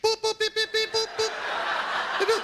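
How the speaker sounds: very high electronic keypad beeps; imitated machine beeps. Imitating bank machine buttons being pushed, high beeping. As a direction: squeak out a run of button tones.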